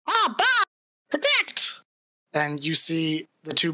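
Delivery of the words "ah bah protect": very high, strained mock shout; Zorg. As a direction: call out a battle order in a squawky high pitch. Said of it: "you'll hear, uh, Zorg kinda shout out".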